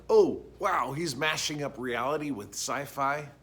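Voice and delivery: loud, high "oh, wow" of feigned wonder; mock amazement. Mock viewer reaction to his own mashup.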